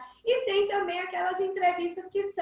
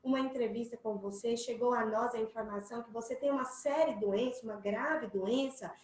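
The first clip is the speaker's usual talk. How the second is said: softer, lower, calm formal tone; serious and respectful; 4 semitones lower, 6 dB quieter than usual. Shown as a demonstration. Reporter Raíssa greets sick Maria softly and formally. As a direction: speak gently as a serious reporter. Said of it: "o tom de voz".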